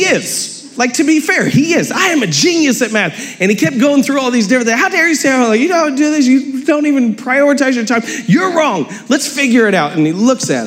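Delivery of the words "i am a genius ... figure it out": much higher, indignant lecturing father; heated dad. Retold or imitated. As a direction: rant at the kid, mock outrage. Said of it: "he got mad".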